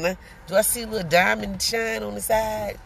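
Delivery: ordinary talk, nothing marked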